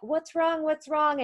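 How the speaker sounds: high, urgent mock worry; worried mother re-enactment. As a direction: act out an alarmed mom on the phone. Acting out worried mother on phone.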